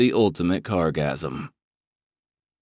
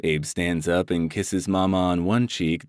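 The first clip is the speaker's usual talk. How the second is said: usual speaking voice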